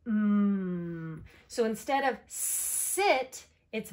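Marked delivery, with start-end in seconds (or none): 0.1-1.2 "Mm" as a long steady hum; letter sound. Shown as a demonstration. Teacher showing M's sound, "Mm" hummed long. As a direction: hold "Mm" with no vowel. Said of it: "What sound does M make".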